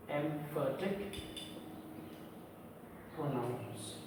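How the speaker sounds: slow, soft and low, word by word; writing aloud. Real, not acted. Teacher writing the heading for students, slow and hushed. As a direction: drop low and stretch it as you write.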